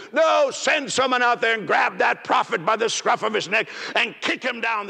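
high, forceful shout at the congregation; preaching shout. Real, not acted. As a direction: call it out with full force. Preacher shouting his ruling.

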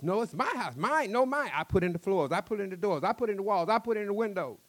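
loud, high, heated claims; angry insistence. Acted. Angry argument over house.

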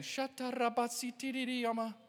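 fast, high stream of nonsense syllables; speaking in tongues. Shown as a demonstration. Preacher showing Suzanne unexplained tongues, quick high babbling. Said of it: "if I come to you speaking with tongues".

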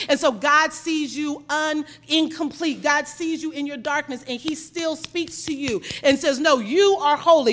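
loud, emphatic sermon declaration; forceful preaching. Real, not acted. Preacher declares God still speaks to you, forceful preaching. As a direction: build to a loud proclamation.

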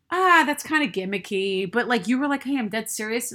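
ordinary delivery

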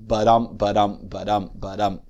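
"Ba-dum" repeated with the weight on "dum"; iambic beat. Shown as a demonstration. Lecturer sounding out Shakespeare's iambic line, iambic beat. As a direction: beat out "ba-dum" like a pulse, starting firm. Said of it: "That's an iambic line".

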